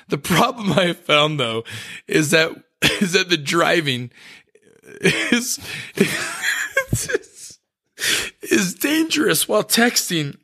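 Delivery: reading through real giggles; cracking up mid-sentence, pitch jumping up; crying with laughter. Real, not acted. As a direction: struggle to read on as laughter keeps breaking in. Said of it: "I'm crying".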